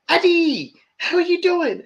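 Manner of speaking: high-pitched acted-out hello; excited greeting. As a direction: call out a delighted greeting, voice way up. Acting Michael's greeting.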